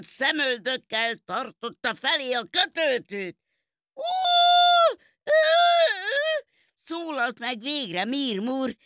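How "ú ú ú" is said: high, loud hooting yelp of fright; frightened high-pitched oohs; frightened cat's "ooh". A narrator's reading. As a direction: let out startled, very high "oohs". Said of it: "rettenetesen megijedt".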